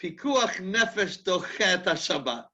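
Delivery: put-on slow, heavy Israeli accent on the Hebrew. Stranger tells the stuck couple the ruling, heavy Israeli accent. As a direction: imitate an Israeli man ruling in his thick Israeli accent. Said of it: "in a heavy Israeli accent".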